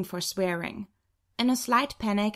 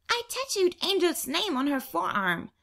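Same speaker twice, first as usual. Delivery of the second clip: put-on higher, proud child reporting; proud little girl; 9 semitones higher than usual. Little sister telling the other twin, a proud childlike voice. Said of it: "Paulina informs her".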